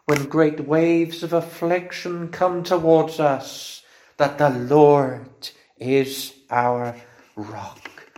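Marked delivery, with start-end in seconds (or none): 4.2-8.1 tender preaching. Acted. Preacher dwells on comfort.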